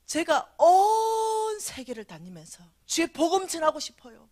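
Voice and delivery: earnest vow. Retold or imitated. Prayer for mission.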